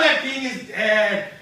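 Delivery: loud, drawn-out mock horror; shocked cry. Macduff finds the king dead, loud drawn-out cry. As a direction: cry it out slowly in staged shock.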